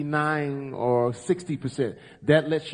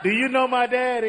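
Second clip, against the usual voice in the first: mock high voice; 8 semitones higher than usual. Co-host jokes in character.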